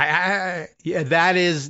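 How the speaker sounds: ordinary delivery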